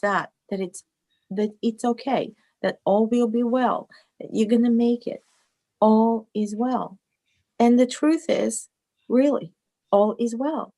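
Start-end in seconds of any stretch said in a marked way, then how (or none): none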